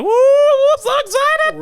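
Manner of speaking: high, slow squealing glee; high drawn-out excited squeal; mock excited girlfriend. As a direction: ham up a giddy girlfriend. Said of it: "That motherfucker was like".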